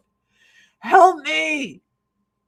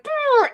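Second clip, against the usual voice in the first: record scratch noise; 4 dB louder than usual. Retold or imitated. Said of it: "like a record scratch".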